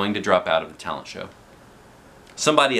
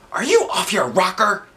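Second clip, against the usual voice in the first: high, loud incredulous; incredulous teacher; 12 semitones higher, 4 dB louder than usual. A narrator's reading. Science teacher challenging the plan, high incredulous. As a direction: demand it.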